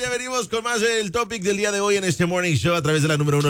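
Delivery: upbeat announcer voice tossing to ads; radio announcer. Acted. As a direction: sell the break like a morning DJ. Host throws to commercial break.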